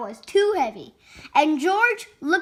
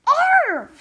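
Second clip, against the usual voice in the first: short, high, loud imitated bark; high puppy bark; 11 semitones higher, 5 dB louder than usual. A narrator's reading. George the puppy finally barks, high loud yelp. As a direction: yelp it like a dog.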